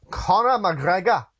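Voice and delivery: name said in a mock Irish accent; a quick, light Irish lilt on the name; jokey Irish accent, slow. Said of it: "That was my Irish accent".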